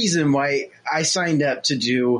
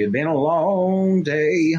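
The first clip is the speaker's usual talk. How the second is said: country voice; 2 semitones higher, much slower than usual. Shown as a demonstration. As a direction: lay on a cartoonish country twang. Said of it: "It's kind of very hick".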